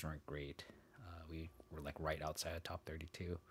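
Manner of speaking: whispering so as not to wake someone; hushed whisper, keeping it down; barely audible. Real, not acted. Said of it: "keep a little quiet 'cause my fiance is sleeping".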